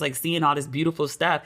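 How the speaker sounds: usual speaking voice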